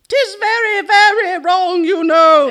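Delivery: high, loud scolding cat; old cat voice. A narrator's reading. Old cats scolding Harriet, high loud cat voice. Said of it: "They're very old cats".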